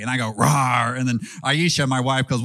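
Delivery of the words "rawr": playful, full papa lion roar. Retold or imitated. Father answering what the papa lion says, playful roar. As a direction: roar like the daddy lion in a toddler's game. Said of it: "what does the papa lion say".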